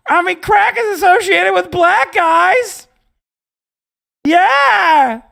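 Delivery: high, loud, drawn-out mocking voice; bumbling hedger impression. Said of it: "he's such a bumbling little bitch".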